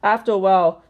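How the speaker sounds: plain reading voice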